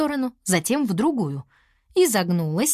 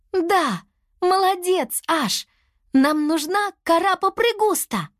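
high-pitched delighted discovery; excited realisation; 11 semitones higher, slower than usual. A narrator's reading. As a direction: cry out as it dawns on you. Character suddenly understands, praises friend. (радостно, высоким голосом)

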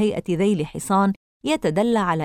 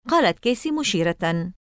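usual speaking voice

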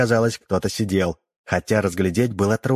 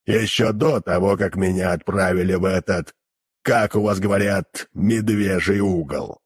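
demon voice. Acted. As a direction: drone it like a hellish creature. Demon keeps his voice.

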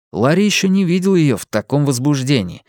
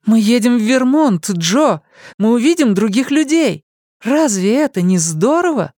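put-on excited young woman; 11 semitones higher than usual. A narrator's reading. Young woman tells the boy they're going to Vermont, thrilled. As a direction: announce it like great news.

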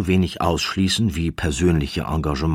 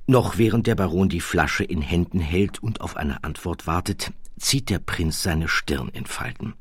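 ordinary delivery

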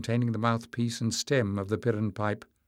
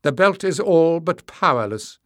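much louder and higher, scornful; contemptuous outburst; 6 semitones higher, 9 dB louder than usual. A narrator's reading. Tyrrell scorns the belt, much louder and higher. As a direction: spit out the contempt.

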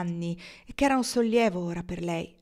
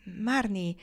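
ordinary delivery, nothing marked

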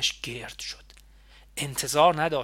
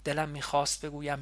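plain reading voice

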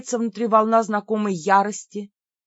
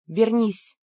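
сухо, ровным голосом велеть; прикажи коротко и сухо; сухой приказ. A narrator's reading. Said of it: "сухо велела снова я". (сухо, повелительно)

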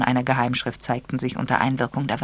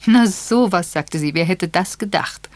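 put-on high, louder amused old lady; 6 semitones higher, 6 dB louder than usual. Miss Marple amused by the recipe, high and lively. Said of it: "Miss Marple war offenbar sehr erheitert".

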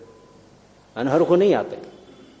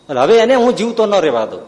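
furious vow; 6 semitones higher, 5 dB louder than usual. Acted. Ishwardan vows to kill.

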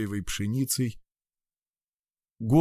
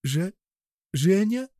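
shocked stammer; 8 semitones higher, slower than usual. Acted. Shocked Alina stammers his name.

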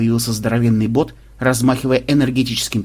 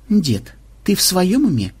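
higher, slow, careful question; delicate inquiry; 7 semitones higher, slower than usual. A narrator's reading. Officer questions the reckless commander's sanity, delicately. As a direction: ask it tactfully, treading gently. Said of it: "деликатно осведомился Ксенобайт".

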